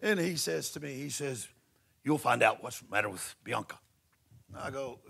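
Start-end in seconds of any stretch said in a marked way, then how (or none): 2.0-3.8 lower, firm German voice; a lightly voiced strict German; stern German man. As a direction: play the strict German guy. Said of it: "you know, he's German".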